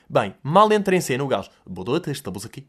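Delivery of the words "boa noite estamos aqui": mock stuffy radio voice. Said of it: "Ficou constipado".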